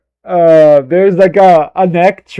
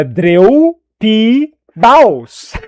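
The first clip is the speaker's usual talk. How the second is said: put-on slow, higher "Dreu P Baus" in a Brazilian accent; mock Brazilian reading, louder; Brazilian pronunciation; 6 semitones higher, 5 dB louder, much slower than usual. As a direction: drawl the name out like a Brazilian would. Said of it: "That's how Brazilian would say that".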